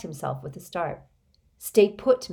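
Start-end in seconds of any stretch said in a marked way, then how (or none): 1.6-2.3 loud, higher command; boy ordering the adult to stay; forceful order. A narrator's reading. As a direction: pin him in place. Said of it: "just as forcefully".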